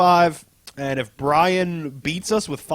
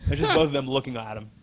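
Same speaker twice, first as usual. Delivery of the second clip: usual speaking voice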